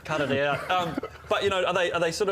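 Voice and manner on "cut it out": laughing, high, slow protest. Real, not acted. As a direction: laugh while telling them to stop. Laughing at teasing.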